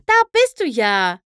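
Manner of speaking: bright call through the trees. A narrator's reading. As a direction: call to her friend from afar. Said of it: "rief Pinkie Pie durch die Bäume".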